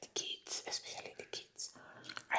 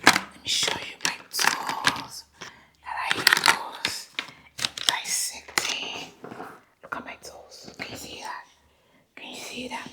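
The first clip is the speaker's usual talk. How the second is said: Doctor Quackity Quack; 7 dB louder than usual. Acted. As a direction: play an overeager fake doctor, still whispering. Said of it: "I'm Doctor Quackity Quack".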